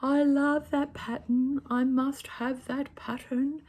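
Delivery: high, slow, dreamy gushing over a pattern; smitten. Retold or imitated. Vlogger acting out falling for a pattern, high slow gush. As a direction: swoon over it like a lovestruck fan. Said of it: "and I was like".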